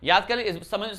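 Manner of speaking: ordinary delivery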